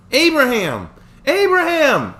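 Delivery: high desperate scream; screaming a name. Acted. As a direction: yell the name in panic. Michelle screaming to wake him.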